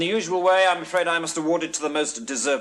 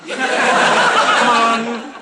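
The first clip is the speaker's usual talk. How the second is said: loud, higher, long drawn-out coaxing; urging call; 4 semitones higher, 5 dB louder than usual. Acted. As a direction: call out to egg on the bidders. Auctioneer urging the bidders.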